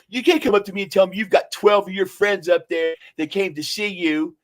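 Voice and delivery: high-pitched, worked-up telling-off; retold angry scolding. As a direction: act out his indignant rant. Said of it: "he started screaming at me".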